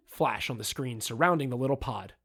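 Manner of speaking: normal talking voice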